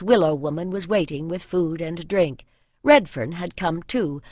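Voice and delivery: plain reading voice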